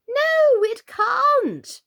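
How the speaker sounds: very high, loud cry of no; emphatic refusal. A narrator's reading. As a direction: shut her down with a squeal. Queen flatly refusing Alice.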